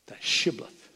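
normal talking voice

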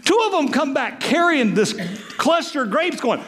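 ordinary talk

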